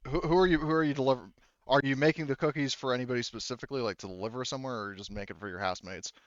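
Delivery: ordinary talk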